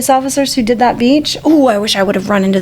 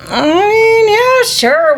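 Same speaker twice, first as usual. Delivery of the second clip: high, stretched-out mock hesitation; hesitant acceptance; 8 semitones higher, much slower than usual. Two friends answer a stranger offering beer, hesitant acceptance. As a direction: agree slowly, as if unsure why not.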